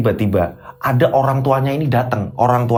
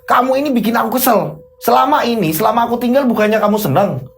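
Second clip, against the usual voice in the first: high, forceful scolding; angry accusation; 8 semitones higher than usual. Acted. Husband accusing wife of enjoying his absence, high and angry. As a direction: silence her, then accuse her heatedly.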